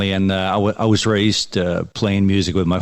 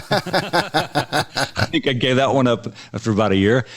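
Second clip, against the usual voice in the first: laughing; 5 semitones higher than usual. Real, not acted. Laughs about quitting classical piano.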